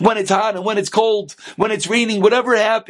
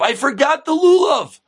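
high-pitched mock scream; acted frantic call; 8 semitones higher than usual. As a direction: shout up to your son in a fluster. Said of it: "And the father screams up".

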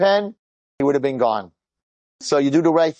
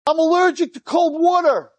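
retold panicked exclamation; 14 semitones higher than usual. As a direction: imitate a panicking boy crying out high. Boy's panicked reveal acted out.

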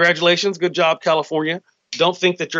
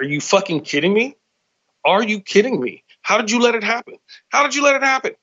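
exasperated disbelief; 9 semitones higher than usual. Acted. Exasperated plea at America.

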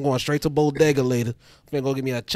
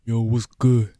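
deep New York voice; 3 semitones lower, much slower than usual. Acted. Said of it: "Lower your tone".

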